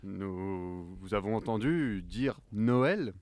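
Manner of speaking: low slow sinister voice; ominous deep voice. Acted. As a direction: loom over him menacingly. Ominous stranger appears.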